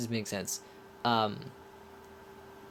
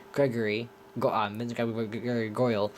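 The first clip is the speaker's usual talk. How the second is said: ordinary delivery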